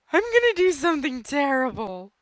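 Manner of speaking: high, loud evil-plan gloat; mock villainous glee. Gamer gloating over his villain scheme, mock evil. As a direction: announce the terrible plan like a cartoon villain.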